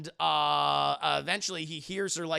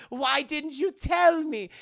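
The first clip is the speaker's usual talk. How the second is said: put-on slow, high, loud German accent; 7 semitones higher, 5 dB louder, slower than usual. Elsa quarrelling with Indy over the diary, German accent. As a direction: demand it in a mock German accent. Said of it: "You have a very German accent".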